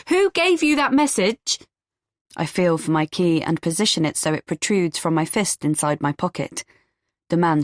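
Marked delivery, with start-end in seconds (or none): 0.1-1.6 chasing and yelling who sent him; shouting after him. A narrator's reading. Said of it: "I follow him and shout".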